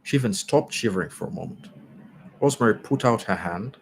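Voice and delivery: ordinary delivery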